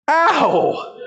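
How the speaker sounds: high sharp cry of pain. Real, not acted. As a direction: yelp as the muscle burns.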